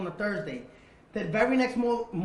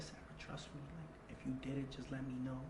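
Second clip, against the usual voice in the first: hushed coaxing; 4 semitones lower, 15 dB quieter than usual. Retold or imitated. Coaxing confession gently.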